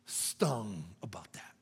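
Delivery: slow low whispered question. Acted. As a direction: ask it gently under the breath. Preacher's intimate question.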